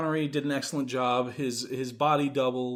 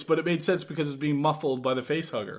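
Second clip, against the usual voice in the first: ordinary talk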